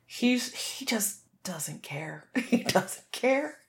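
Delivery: hushed, slow whisper; whispered aside. Real, not acted. Owner whispering about her carefree dog, softly. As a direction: breathe it out, barely voiced.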